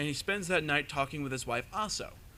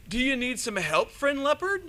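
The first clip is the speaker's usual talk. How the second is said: mock-sweet offer; 8 semitones higher, 5 dB louder than usual. Anansi fakes kindness to trapped leopard.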